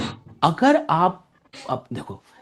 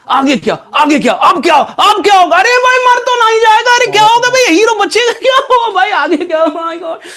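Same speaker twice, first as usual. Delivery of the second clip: much higher, louder, excited; eager suspense; 12 semitones higher, 8 dB louder than usual. Shown as a demonstration. A hooked viewer yelling what happens next, excited. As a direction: shout it like a viewer dying to know what's next. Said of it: "इंसान का दिमाग जो आगे क्या हो".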